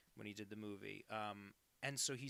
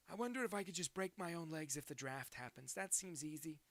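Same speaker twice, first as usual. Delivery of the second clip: character voice; 6 semitones higher than usual. Acted. Reading chat quote in voice.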